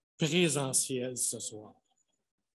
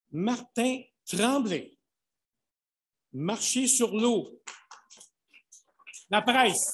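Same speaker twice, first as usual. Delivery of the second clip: loud, high, slow name calling; booming prize announcer; 6 semitones higher, 6 dB louder, slower than usual. Acted. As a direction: announce the title to the room. Announcing nominees.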